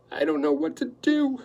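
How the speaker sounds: a fretful, high housekeeper's whimper. Acted. Housekeeper fretting to Quillerin over the table, whimpering. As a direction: plead it anxiously, near tears. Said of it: "she was whimpering".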